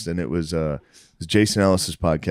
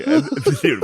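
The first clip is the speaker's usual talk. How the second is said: ordinary delivery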